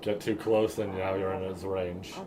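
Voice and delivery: ordinary talk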